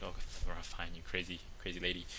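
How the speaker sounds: grumbling husband. Retold or imitated. Husband brushing off his wife, grumbling. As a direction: sigh it out dismissively. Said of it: "So he's like".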